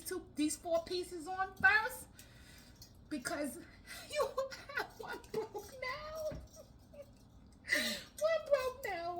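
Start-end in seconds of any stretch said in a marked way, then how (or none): 3.1-7.8 laugh-crying. Real, not acted. Laughing-crying over broken nail. 8.2-9.2 mock crying. Real, not acted. Mock crying over nail.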